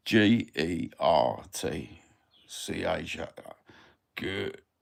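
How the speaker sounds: letter by letter, then "Gotcha" as GUR, pause, CHA; spelling it out. Shown as a demonstration. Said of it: "If you wanna spell it".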